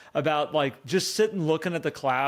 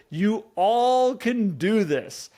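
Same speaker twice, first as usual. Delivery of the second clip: ordinary talk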